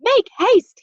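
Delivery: high, loud hurry-up shout; urgent yell. A narrator's reading. Teddy hurrying Jack and Annie into a room, urgent yell. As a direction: yell at them to run. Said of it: "he yelled".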